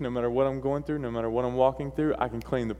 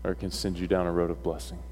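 solemn sermon closing; 5 semitones lower, 6 dB quieter than usual. Real, not acted. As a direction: bring the message home softly, with long pauses. Preacher's solemn closing exhortation.

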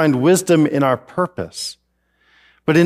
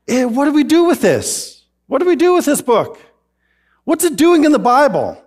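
higher, puzzled questioning; mock bewildered; 9 semitones higher than usual. Real, not acted. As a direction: throw up your hands in mock confusion. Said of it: "rhetorical puzzlement".

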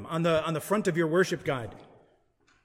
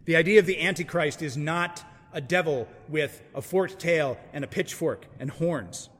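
ordinary delivery

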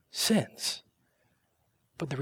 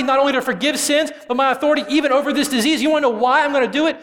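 much higher and louder, building fast at the end; forceful challenge; 9 semitones higher, 8 dB louder than usual. Pretend. Jesus to the doubting scribes, loud and forceful.

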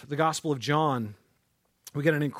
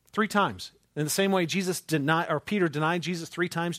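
ordinary delivery

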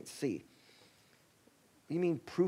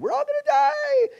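much higher, loud panicked yell; frightened disciples shrieking in the storm; panicked scream; 15 dB louder than usual. Acted. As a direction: scream it like you're drowning.

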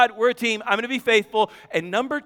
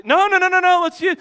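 much higher, louder rapid no's; eager insistence; 9 semitones higher, 4 dB louder, faster than usual. Retold or imitated. Wife insisting on the car toilet, excited and high. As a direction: jump in eagerly, no no no.

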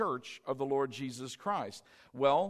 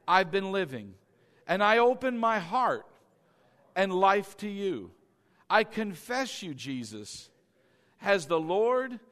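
led prayer; 2 semitones higher, 5 dB louder than usual. Shown as a demonstration. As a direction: pray it aloud for them to repeat, pausing after each phrase. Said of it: "We say this with me".

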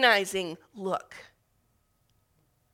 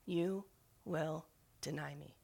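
much softer and slow, like a memory echoing; remembered words; 7 semitones lower, 13 dB quieter, slower than usual. Retold or imitated. Jesus's words returning to Peter's mind, soft and slow. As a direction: let it drift back, hushed and slow. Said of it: "the words of the Messiah come back to him".

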